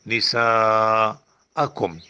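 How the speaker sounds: نِسَاءَكُمْ very slow with the سا held long; held madd in نِسَاءَكُمْ. Shown as a demonstration. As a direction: stretch the سا in نِسَاءَكُمْ. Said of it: "سین زبر الف مد سا".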